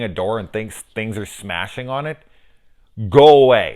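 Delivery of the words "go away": shouted go away, loud and high; mock shout. Vlogger voicing a warning at the gawking crowd, a loud mock shout. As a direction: yell it to scare the crowd off.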